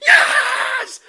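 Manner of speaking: a joyful yelling laugh, very high; ecstatic laughing shout. Real, not acted. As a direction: scream for joy as the penalty is given. Fan celebrates penalty decision.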